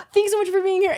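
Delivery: a mock influencer thanking her viewers. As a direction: gush at the camera like a vlogger. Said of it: "it's like the influencer".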